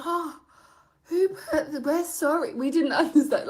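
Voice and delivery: high, halting imitation of flustered apology; mock-distressed stammered apology, breaking into laughter; pleading travellers. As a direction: plead innocence in a put-on high voice. Voices travellers pleading innocence at customs.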